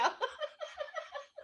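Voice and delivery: high giggle. Real, not acted. Friend giggling after teasing the host. Laughing at own joke.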